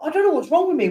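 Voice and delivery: talking through a yawn; words pulled long by a yawn. Real, not acted. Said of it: "I just keep yawning".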